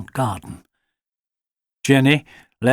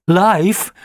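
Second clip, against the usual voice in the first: high, louder shocked gasp; 7 semitones higher, 5 dB louder than usual. A narrator's reading. Alfie hears he might be in for life, shocked gasp. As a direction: gasp the word in disbelief. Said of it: "gasped Alfie".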